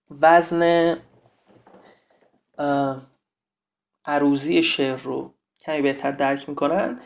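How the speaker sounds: normal talking voice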